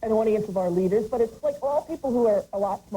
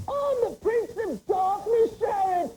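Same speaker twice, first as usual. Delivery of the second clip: imitating [name]; 10 semitones higher than usual. Host quoting [name]'s best line, in mock grandeur. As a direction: declare it grandly like an indignant rock star. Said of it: "when he said".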